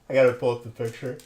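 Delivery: ordinary talk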